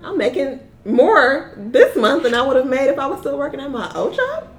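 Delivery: high, delighted, disbelieving squeal; excited disbelief. Retold or imitated. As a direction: gush like you can't believe your luck. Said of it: "and I'm like".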